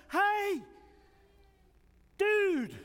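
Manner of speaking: high-pitched, drawn-out call; indignant high holler, each word on its own. Acted. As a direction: call out to get someone's attention. Disciples call out to Jesus.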